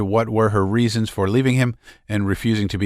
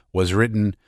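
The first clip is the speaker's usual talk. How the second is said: usual speaking voice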